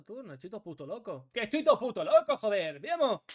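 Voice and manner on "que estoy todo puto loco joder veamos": much louder, yelling with excitement; hyped-up gamer yell; excited shout. Real, not acted. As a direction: let it burst out at full volume. Hyped after a big turn.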